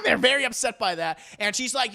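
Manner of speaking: normal talking voice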